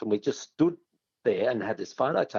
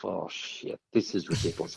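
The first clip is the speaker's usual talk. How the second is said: scared whisper; 6 semitones lower, 6 dB quieter than usual. Retold or imitated. Inner panic halfway up ladder.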